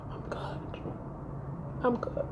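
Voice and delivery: barely voiced, very soft brush-off; quiet dismissal. Woman ends her text to him, quietly dismissive. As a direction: let it drop away almost to nothing.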